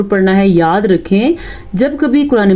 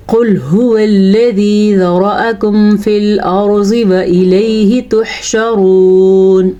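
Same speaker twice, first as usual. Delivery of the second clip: slow, measured tajweed recitation; Quranic recitation; much slower than usual. Shown as a demonstration. Teacher reciting a verse for students, slow measured tajweed. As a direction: recite with long, sustained vowels.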